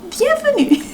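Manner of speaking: high, singsong welcome; playful welcome. Pretend. Greeting first-time viewers, playful high singsong.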